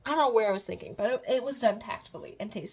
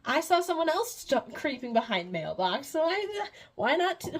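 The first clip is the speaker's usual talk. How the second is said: breezy demoness; 7 semitones higher, 5 dB louder than usual. Retold or imitated. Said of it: "and she's like".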